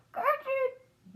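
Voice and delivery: very high-pitched parrot squawk; parrot scream. A narrator's reading. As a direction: screech the name like a parrot. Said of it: "the parrot screamed again".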